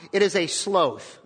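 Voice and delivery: sloth said with a long British oh vowel, sloh-th; a light British nature-documentary accent on sloth. Retold or imitated. Pastor imitating David Attenborough's British accent for the congregation, lightly done. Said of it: "in his great British accent".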